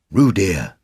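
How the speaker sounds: mild motherly reproach; reproachful. A narrator's reading. Kanga checking little Roo, reproachful. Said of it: "said Kanga reproachfully".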